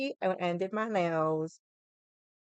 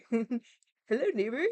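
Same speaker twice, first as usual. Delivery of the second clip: lightly voiced gentle TV host greeting; a quick soft hint of a kids' show host; Mister Rogers; 5 dB quieter, slower than usual. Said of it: "a little Mister Rogers".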